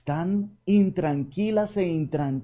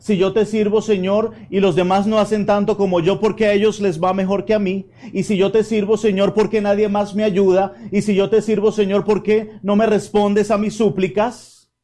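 loud, higher pleading complaint to the Lord; repeated loud "Si yo te sirvo" in a higher, aggrieved pitch; plaintive reclamation; 7 semitones higher, 7 dB louder than usual. Acted. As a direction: demand answers like a worn-out servant. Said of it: "Y el reclamo es".

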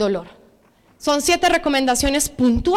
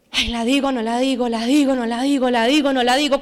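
low, wavering self-talk; lightly acted indecision; dithering inner debate; 4 semitones lower, faster than usual. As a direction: go back and forth under your voice, unable to decide. Acting out hesitant inner debate at work.

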